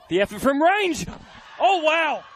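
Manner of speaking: rising excited shout at the strike; excited goal call. Real, not acted. Long-range goal in live call.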